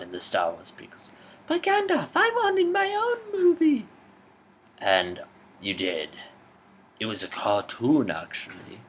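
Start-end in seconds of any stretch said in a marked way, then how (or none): 1.5-3.9 very high whining hobbit voice; high petulant complaint; whiny hobbit. Acted. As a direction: plead like a sulky little hobbit. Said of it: "The Hobbit".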